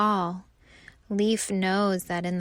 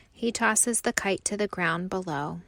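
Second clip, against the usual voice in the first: ordinary delivery, nothing marked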